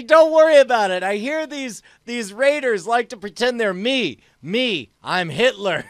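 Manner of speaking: loud, high in-character bluster; posing as Hitler. Acted. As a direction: declaim it to the raiders as an impostor. Player performs his character posing as Hitler.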